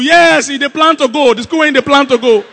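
loud, hammed-up wail; mock mourning. Professional crier covering a mistake about the dead, loud mock wail. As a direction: cry it out at full volume like a paid mourner. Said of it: "they are professional criers".

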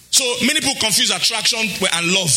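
usual speaking voice

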